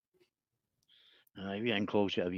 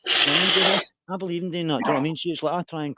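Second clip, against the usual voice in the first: emphatic; 7 semitones higher, 10 dB louder than usual. Real, not acted. Emphatic burst in his talk.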